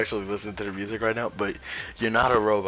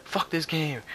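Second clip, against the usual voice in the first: mock frustrated outburst, pitched up; pissed off; 7 semitones higher, slower than usual. Gamer imitating someone stuck fuming at the game, pissed off. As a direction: act out a gamer's mild rage. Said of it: "fucking pissed off".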